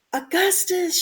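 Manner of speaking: high call of the name; mother shouting. A narrator's reading. Mother shouting at her son leaning over the river. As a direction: cry out to the boy. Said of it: "shouted Missus Goop".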